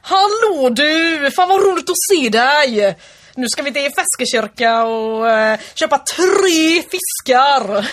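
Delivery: Gothenburg accent. Shown as a demonstration. Imitating dialects on request, exuberant Gothenburg accent. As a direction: bellow cheerily in broad Gothenburg dialect. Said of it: "Göteborgska".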